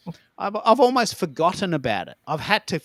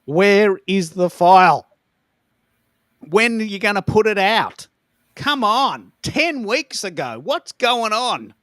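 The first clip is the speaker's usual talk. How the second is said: louder, higher, slowing on the end, fed-up demands; retelling his frustration at the late file; exasperated; 5 semitones higher, 6 dB louder, slower than usual. Retold or imitated. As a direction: vent at a mate who won't deliver. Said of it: "And I'm thinking".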